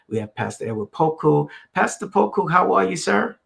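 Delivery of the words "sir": normal talking voice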